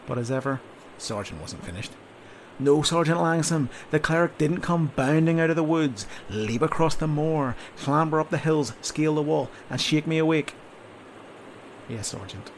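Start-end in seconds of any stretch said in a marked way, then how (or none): 2.6-10.5 louder, slower mocking sarcasm; sarcastic sergeant. 11.9-12.5 softer, resigned "Yes, Sergeant"; defeated. Acted. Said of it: "agreed Rior, defeated".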